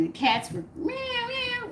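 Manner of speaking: high mock meow, rising then dropping; imitated cat meow. Cats meowing when she came home.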